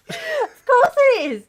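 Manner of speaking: high, drawn-out laughing reply; laughing agreement. Real, not acted. Host agreeing it was the perfect time, high laughing delivery. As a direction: squeal it slowly through laughter.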